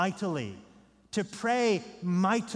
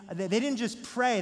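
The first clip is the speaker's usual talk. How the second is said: ordinary delivery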